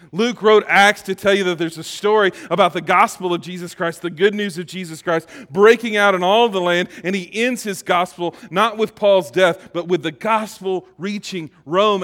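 full pulpit proclamation; preaching. Acted. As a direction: preach it out with fervor. Preaching climax.

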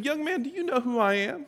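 high-pitched, rising challenge; affronted old lady. Acted. Elderly church visitor challenges the young usher, affronted.

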